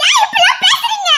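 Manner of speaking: laughing outcry. Acted. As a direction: cry out half laughing at his words. Wife cries out, laughing. (சிரிப்புடன் கூச்சல்)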